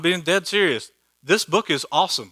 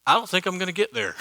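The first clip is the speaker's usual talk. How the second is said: higher, quick mock doubtful voice; the preacher voices his listeners' doubt, quick and higher; 6 semitones higher, faster than usual. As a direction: play the worried skeptic in the pew. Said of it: "you're probably thinking".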